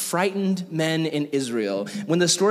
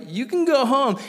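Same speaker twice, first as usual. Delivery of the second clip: mock Gideon dismissal; 10 semitones higher than usual.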